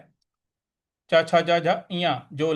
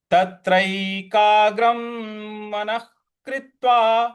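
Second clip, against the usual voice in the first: measured chant; verse recitation; 4 semitones higher, 3 dB louder, much slower than usual. Acted. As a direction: recite each word.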